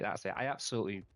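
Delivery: ordinary talk, nothing marked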